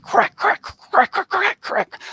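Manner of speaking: sharp imitated crack sounds, jumping high; mock electric crackle; crackling imitation. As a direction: mimic an arc welder crackling.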